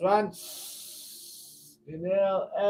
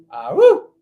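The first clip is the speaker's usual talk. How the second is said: high euphoric whoop after exhaling; excited whoop; 13 dB louder than usual. Real, not acted. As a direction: let out a joyful cry of release. Joyful release after held breath.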